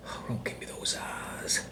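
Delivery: soft breathy whisper to the dog. Acted. Owner whispers to his dog about its stare. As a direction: whisper it so only the dog hears.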